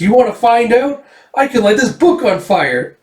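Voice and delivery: put-on high-pitched, cocky little girl; defiant girl. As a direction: dare them in a girlish voice. Erica threatens to burn book.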